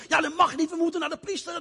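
high, insistent objection; objecting leper. Acted. Second leper argues they must see the priest, high insistent. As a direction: hold the rule firmly.

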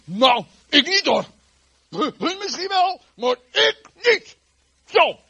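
loud, high, drawn-out protest; boastful Peter. Acted. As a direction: proclaim it slowly and loudly, every word hammered. Voicing boastful Peter loudly.

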